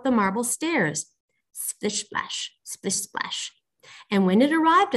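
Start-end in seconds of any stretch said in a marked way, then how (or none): 1.5-3.5 soft whispered sound effect; whispered splashing. A narrator's reading. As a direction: whisper it like wet footsteps creeping up. Imitates wet steps on the stairs.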